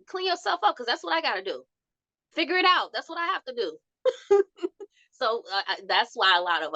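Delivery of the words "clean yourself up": mocking scold.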